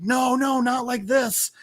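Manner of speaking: high-pitched dying protest; mock despair. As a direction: act out a doomed man's plea, without much volume. Falling Avery about to die.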